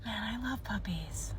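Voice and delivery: slow dreamy whisper; adoring whisper. Acted. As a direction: whisper it to yourself, smitten. Acted adoring aside about puppy.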